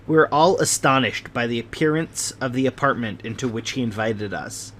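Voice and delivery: ordinary delivery, nothing marked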